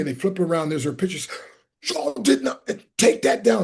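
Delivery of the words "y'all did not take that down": high, indignant demand; mock outrage. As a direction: imitate her scandalized protest. Retold outrage at coworkers' prank.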